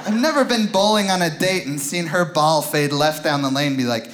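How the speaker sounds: usual speaking voice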